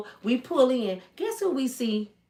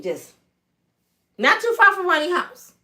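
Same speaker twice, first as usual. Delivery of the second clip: usual speaking voice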